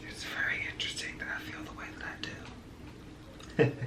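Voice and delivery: whispered confession, breathy and low; whispering. Real, not acted. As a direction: whisper it to yourself. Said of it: "whispered aside before intro".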